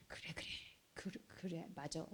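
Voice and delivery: a father gently agreeing with his son; hushed, tender-sounding father's reply; father's soft assent. Retold or imitated. Father answers returning son.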